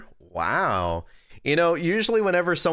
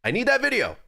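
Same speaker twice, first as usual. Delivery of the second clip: mock demand; 10 semitones higher than usual. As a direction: snap the final word like a bratty order. Demanding apology video.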